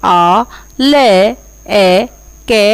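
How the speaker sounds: आ, ले, ए, के stretched out very slowly one by one; slow syllable spelling. Shown as a demonstration. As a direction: dictate each sound slowly for the class. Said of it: "बे". (बहुत धीरे-धीरे, एक-एक अक्षर)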